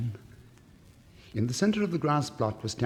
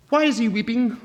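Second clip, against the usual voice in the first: a much higher, louder little lizard voice; a small sharp lizard asking; 12 semitones higher, 10 dB louder than usual. Acted. Lizard asks.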